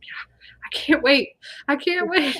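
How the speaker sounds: half-whispered can't-wait excitement; excited whisper. Retold or imitated. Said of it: "I'm getting excited".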